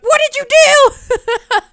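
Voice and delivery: slow, high, loud yell breaking into laughter; hammed-up yell. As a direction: stretch it out and crack up. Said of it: "we gotta have him argue".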